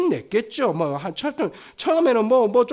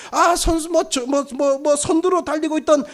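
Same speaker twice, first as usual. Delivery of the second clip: high, running race commentary; sports commentator; 6 semitones higher, 4 dB louder than usual. Acted. Commentator calls patients racing to the pond, mock play-by-play. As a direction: call it like a live race, pitch held high. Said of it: "중계가 시작될 거예요".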